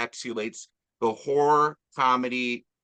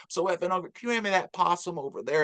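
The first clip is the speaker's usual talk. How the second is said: put-on Southern accent; 4 semitones higher, faster than usual. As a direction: ask for the possum in a mild Southern accent. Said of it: "she's got that Southern accent".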